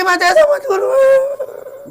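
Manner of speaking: high, drawn-out crying plea; wailing plea. Acted. Old mother begging her son not to throw her out, wailing. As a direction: stretch it into a sobbing beg.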